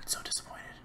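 barely audible, whispered aside; disappointed whisper. Real, not acted. Gamer let down about the parrot, disappointed whisper. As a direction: whisper the letdown to yourself. Muttering to self after letdown.

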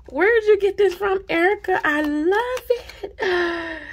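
high, loud, gushing cry; delighted squeal. Real, not acted. Vlogger gushing at Erica, high and loud. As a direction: call out to her in delight.

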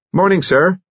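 high, hearty postman greeting; cheery village postman. A narrator's reading. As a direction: call out a cheery hello.

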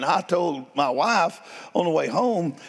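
ordinary talk, nothing marked

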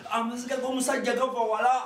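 usual speaking voice

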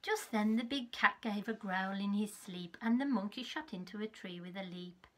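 put-on lively read-aloud for small children; story narration. Teacher reading a picture book to her class. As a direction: tell it like a bedtime storybook.

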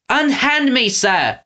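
high, mild indignant shriek. Acted. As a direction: shriek it high without full volume. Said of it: "Winnie shrieked".

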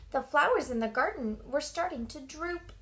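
ordinary delivery, nothing marked

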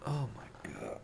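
very soft, whispered shock; hushed dread. Real, not acted. Viewer watching the man go upstairs, hushed dread. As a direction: let it out under the breath.

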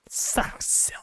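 softly whispered mock chiding; playful whisper. Player ends chiding the Mongols, playful whisper. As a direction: trail into a teasing whisper.

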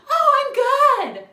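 high, loud chirpy fake cheer; fake Midwest nice. Answering the cashier, fake cheery Midwest politeness. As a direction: play the overly nice customer. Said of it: "like a dumb fucking nice Midwest person".

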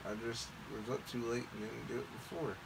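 usual speaking voice

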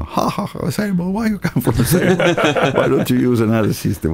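high laughing ridicule; mocking laugh. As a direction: laugh the question out. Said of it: "And he laughed there".

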